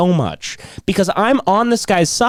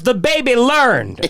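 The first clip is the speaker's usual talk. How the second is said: slow, higher-pitched boast; mock triumph; 7 semitones higher, much slower than usual. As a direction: draw it out like a man taking credit. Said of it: "He goes".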